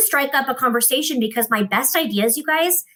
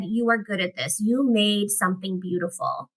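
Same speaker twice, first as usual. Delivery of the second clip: earnest praise; 3 semitones lower, 5 dB quieter than usual. Retold or imitated. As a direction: say it gently, looking her in the eye. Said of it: "looked me dead in the eye".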